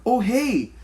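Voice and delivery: high, chirpy mock greeting from a stranger. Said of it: "This one lady, she goes".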